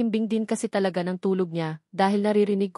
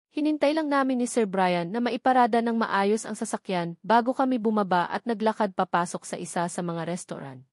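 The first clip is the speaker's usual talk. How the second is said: ordinary delivery, nothing marked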